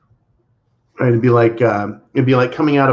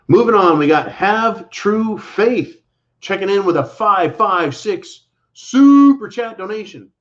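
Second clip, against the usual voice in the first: higher, louder, slower announcer read; upbeat announcer; 9 semitones higher, 3 dB louder than usual. Acted. Host announcing a viewer's super chat to the audience, upbeat announcer voice. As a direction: announce the donation like a show host.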